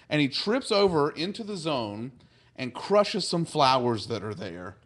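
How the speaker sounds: ordinary talk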